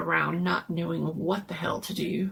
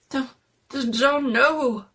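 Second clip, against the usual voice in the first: high, loud, slow stuttering; frightened stammer; 6 semitones higher, 5 dB louder, slower than usual. Acted. Jones stammering to his friends, frightened. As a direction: stammer in fear.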